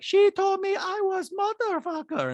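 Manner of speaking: high, slow monotone send-up; mock accusation held on one high note; Diana. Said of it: "And Diana's like".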